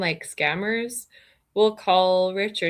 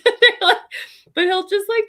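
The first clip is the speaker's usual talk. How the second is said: laughing; 13 semitones higher, 8 dB louder than usual. Real, not acted. Laughing mid-story.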